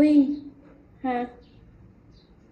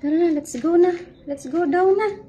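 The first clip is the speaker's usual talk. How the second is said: loud, higher call to come along; rally the pets to go, raised voice; 5 semitones higher, 7 dB louder than usual. Real, not acted. Calling pets to leave.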